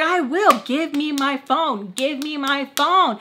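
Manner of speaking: high, slow, emphatic demand; acted-out exasperated insistence; angry demand.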